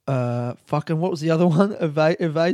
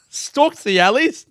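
laughing; 9 semitones higher, 6 dB louder than usual. Real, not acted. Laughs at own phrase.

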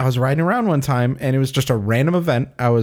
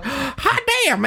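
excited prospector; 12 semitones higher than usual. Retold or imitated. Prospector finds gold nugget.

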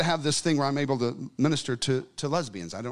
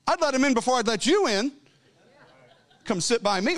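retorting; 8 semitones higher than usual. Retold or imitated. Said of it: "I'm like".

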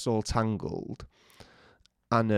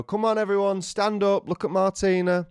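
higher, louder call to the guests; rally the room to stand; 9 semitones higher, 4 dB louder than usual. Retold or imitated. Calling the wedding guests to stand up. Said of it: "I go".

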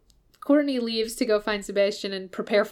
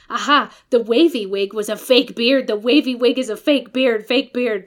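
louder, exclaiming the discovery; triumphant realization; 2 semitones higher, 7 dB louder than usual. Retold or imitated. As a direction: announce it with a grand aha.

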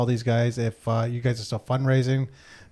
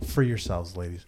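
cult leader; 6 semitones lower, 4 dB quieter than usual. Acted. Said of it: "spoken like a true cult leader".

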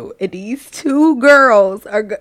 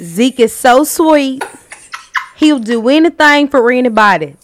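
demonstrated country accent; 3 semitones higher, 5 dB louder than usual. Shown as a demonstration. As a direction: lean into country vowels, a little higher. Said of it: "That's country".

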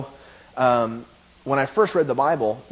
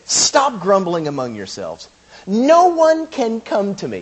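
slow, forceful pronouncement building higher and louder; emphatic command; 9 semitones higher, 7 dB louder, slower than usual. Retold or imitated. Preacher voicing Jesus to the grumblers, emphatic. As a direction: stretch it out with weight, rising to a proclamation. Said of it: "It is emphatic".

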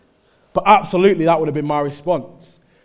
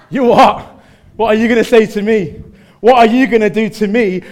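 loud, raised-pitch swaggering taunt; cocky challenge; 6 semitones higher, 9 dB louder than usual. Acted. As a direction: challenge them like an untouchable kid. Acts untouchable kid taunting others.

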